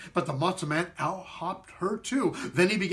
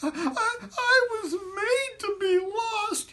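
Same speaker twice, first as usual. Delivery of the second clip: very high, slow, sobbing squeak; tearful squeak; much slower than usual. Acted. Matzo man weeping to David and Rachel, high slow sobbing. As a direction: cry it out in a tiny high voice. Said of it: "burst into tears".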